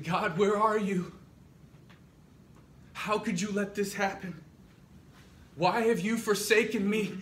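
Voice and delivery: loud, high, full-voiced outcry; anguished cry to God. Abraham looking up at the sky, shouting in anguish.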